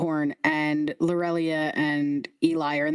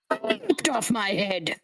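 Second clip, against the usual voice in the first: indignant head; 3 semitones higher, slower than usual. Acted. Head repeating the insult.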